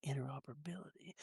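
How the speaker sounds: quick, very soft murmur; demonstrative murmur. Shown as a demonstration. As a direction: drop INTEROPERABILITY right down, quick and hushed. Demonstrating how the word sounds.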